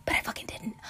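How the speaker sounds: sheepish whisper under the breath; whispered aside. Real, not acted. Host admits to viewers a planning mistake, whispering. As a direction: mutter the confession off to the side.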